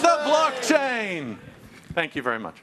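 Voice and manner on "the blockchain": milk every syllable of blockchain; slow, theatrical payoff; drawn-out punchline. Acted. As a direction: stretch it out very slowly, like a big reveal. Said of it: "is everybody ready?".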